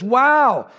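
loud, high delighted exclamation; delighted wow. Acted. Policeman amazed by the trainee, loud delighted wow. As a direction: burst out amazed and pleased. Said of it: "with a beaming smile on his face".

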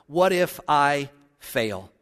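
ordinary talk, nothing marked

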